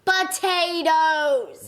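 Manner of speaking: loud, long-drawn, stuffed-up "Potatoes"; blocked-up nose. Real, not acted. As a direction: announce it slowly through a blocked nose. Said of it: "you really are blocked up".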